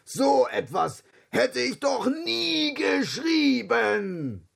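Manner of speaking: high, slow mild roar; a hint of lion roar. A narrator's reading. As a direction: protest as the offended lion. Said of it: "brüllte der Löwe".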